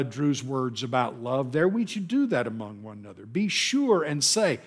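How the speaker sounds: normal talking voice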